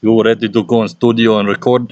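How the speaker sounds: put-on lightly Russian-accented teacher; a hint of a stern Russian teacher; a touch of a gruff Russian accent; Russian teacher impression. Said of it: "And you go".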